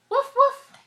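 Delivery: sharp, high, loud puppy woofs; two short punchy high woofs; puppy barks. Acted. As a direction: bark it out like a little dog. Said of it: "What do puppies say?".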